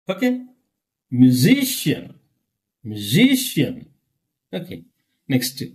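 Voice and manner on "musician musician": "Musician" slowly, leaning on the SI; stress on SI. Shown as a demonstration. Teacher showing where stress is, lengthened stressed syllable. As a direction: hit the second syllable of "Musician". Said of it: "Stress is here".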